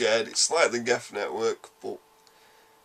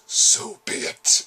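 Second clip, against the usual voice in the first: slow, whispered menacing villain voice; Harbinger; much slower than usual. Retold or imitated. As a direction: draw it out in an ominous, voiceless rasp. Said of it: "in, like, Harbinger's voice".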